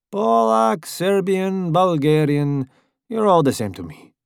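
high, sneering driver voice; Italian driver voice. A narrator's reading. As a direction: sneer at him. Italian mocks.

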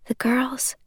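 dazed whisper. Acted. Juliet asking Warner, whispered in a daze. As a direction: breathe it out, barely there. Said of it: "I whisper".

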